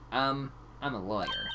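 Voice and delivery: ordinary talk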